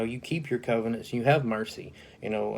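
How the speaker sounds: ordinary delivery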